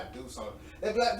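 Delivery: ordinary talk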